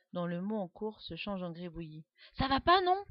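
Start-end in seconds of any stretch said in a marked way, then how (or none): 2.4-3.0 much higher, loud indignant snap; bristling. A narrator's reading. Guillaume shaken mid-word by his friend, indignant snap. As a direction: protest sharply at being shaken. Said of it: "se rebiffe Guillaume".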